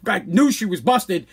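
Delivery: plain reading voice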